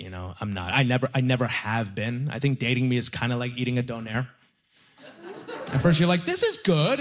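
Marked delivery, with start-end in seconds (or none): 6.2-7.0 high-pitched, delighted approval; pleased reaction. Acted. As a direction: act out an eager first impression, pitched way up. Acting a pleased first bite.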